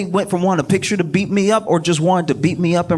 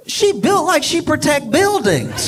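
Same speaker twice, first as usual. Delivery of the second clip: laughing [name] quote; 13 semitones higher than usual. Retold or imitated. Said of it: "[name] is like".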